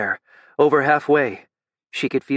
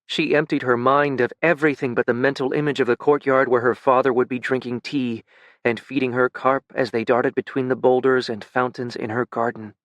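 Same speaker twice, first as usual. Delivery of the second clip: plain reading voice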